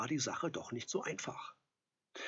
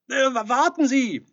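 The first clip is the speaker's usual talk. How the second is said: louder, higher, stammering call; 12 semitones higher, 12 dB louder than usual. A narrator's reading. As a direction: stop him before he leaves. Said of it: "rief Edelstein". (Edelstein ruft dem Teufel stammelnd und laut nach)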